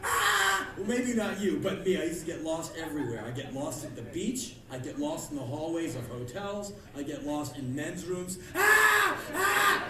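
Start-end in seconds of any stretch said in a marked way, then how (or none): 0.0-0.7 loud panicked cry; mock freak-out "Ah!"; freaked-out scream. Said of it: "All you do is freak out". 8.5-9.9 high, loud yelp of alarm; two piercing panicked cries; panicked scream. Acted. Panicking, lost in men's rooms.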